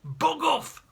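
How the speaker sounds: high, loud dismissive shout; angry cry. Acted. Taxi driver storming out, shouting at the uncle. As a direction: yell it as you storm out. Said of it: "cried the taxi driver".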